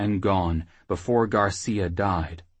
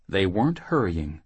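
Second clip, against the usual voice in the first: ordinary delivery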